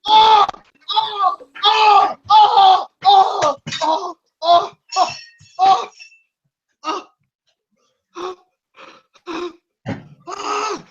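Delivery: long run of high, loud oh cries; wild wailing. Acted. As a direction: howl out in anguish. Wordless acted outburst.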